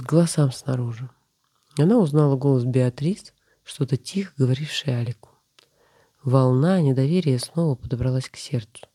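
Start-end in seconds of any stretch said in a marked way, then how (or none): none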